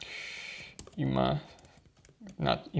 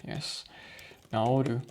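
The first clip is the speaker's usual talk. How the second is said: ordinary talk, nothing marked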